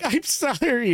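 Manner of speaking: high, whimpering imitation of a crying woman; hammed-up teary sorry; mock sobbing apology. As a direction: mimic the bawling driver squeaking out sorry. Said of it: "she's bawling".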